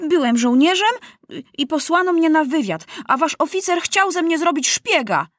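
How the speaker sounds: put-on high, confident boy's voice; bold. A narrator's reading. Maciuś accusing the officer in court, bold. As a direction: press on boldly, pitched high. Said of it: "ciągnął śmiało Maciuś".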